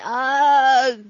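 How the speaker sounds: loud straining "ugh"; drawn-out, high effortful grunt, imitated. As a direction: grunt as if heaving with all your strength. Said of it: "that's his grunt".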